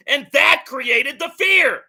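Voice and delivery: loud, slowed, punching the word fear; angry correction, stress on fear; emphatic shout. Real, not acted. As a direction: drive it home, loud and slow, landing on fear. Hammering his point in argument.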